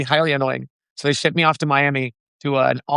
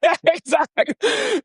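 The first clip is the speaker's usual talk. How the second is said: laughing; 12 semitones higher, slower than usual. Real, not acted. Laughing agreement with host.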